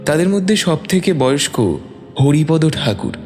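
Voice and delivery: ordinary talk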